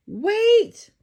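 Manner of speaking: high, loud call after her; cry out. Acted. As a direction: shout after the girl running away. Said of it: "cried the prince".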